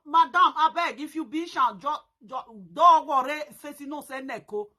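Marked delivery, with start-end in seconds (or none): none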